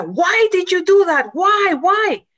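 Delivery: high, pleading cries of why, retold by the preacher; a man's crying question, acted out high; imitated tearful plea. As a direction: plead through tears, voice pitched well up. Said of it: "él le lloraba y le decía".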